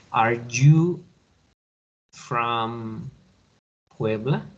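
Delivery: "Are you from Puebla?" as a clear question, rising on you; teacher modelling the rise of a question; question intonation. Shown as a demonstration. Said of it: "debemos dar una entonación a lo que es la pregunta". (entonación de pregunta ascendente)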